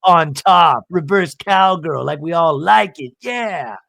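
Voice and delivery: loud, raucous acted-out teasing; hammed-up and loud; mock sex noises. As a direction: belt it out as a mock bedroom cheer. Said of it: "we could have made sex noises".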